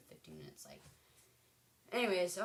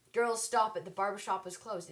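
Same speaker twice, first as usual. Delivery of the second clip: loud, higher mock scolding, easing off at the end; imitated teacher yell; 3 semitones higher, 7 dB louder than usual. Teacher to girls doing hair, imitated yell. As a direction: imitate a teacher yelling at her class. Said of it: "she would just yell that over and over".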